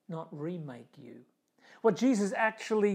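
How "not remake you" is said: whispered, softer rhetorical question; hushed rhetorical whisper. Shown as a demonstration. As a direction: lean in and whisper it.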